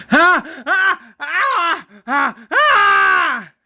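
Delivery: high, loud repeated screams; screaming. Acted. Pregnant woman starts to scream in labor, high screams. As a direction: scream in bursts, last one long. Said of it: "Eileen starts to scream".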